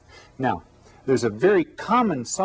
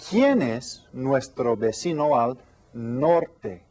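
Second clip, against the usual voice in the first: slow, careful question to the class; teacher slowly posing a new question to the class; slow question read; slower than usual. Shown as a demonstration. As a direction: ask it slowly so they follow. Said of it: "Hoy tengo otra pregunta nueva".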